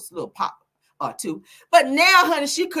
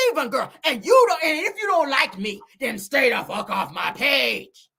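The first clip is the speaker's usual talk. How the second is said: rival's indignant voice; 3 semitones higher than usual. Acted. Acting the rival banishing critics, indignant. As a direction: warn them off, stretching the last words. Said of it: "reading the girls and Nell voice".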